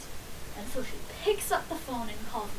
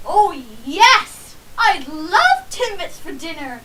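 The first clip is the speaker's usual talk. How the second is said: much louder, delighted exclamation; excited; 3 semitones higher, 13 dB louder, slower than usual. Retold or imitated. Boy answering his mom's dinner offer, loud delighted excitement. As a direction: gush with childish glee. Kid thrilled by Timbits for dinner.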